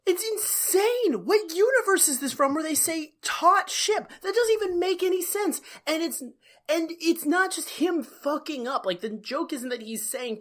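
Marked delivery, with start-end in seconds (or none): 0.1-8.9 high-pitched, exasperated rant; indignant disbelief. Real, not acted. Co-host railing against "taut ship", indignant. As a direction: protest as if it makes no sense at all.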